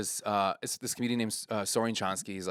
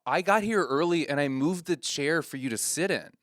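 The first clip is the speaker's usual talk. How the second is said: higher, louder mock reproach; upset, hurt; 6 semitones higher, 4 dB louder than usual. Comedian reproaching a heckler about the chair, imitated upset. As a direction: act out a wounded guilt trip. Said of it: "my friend gets upset".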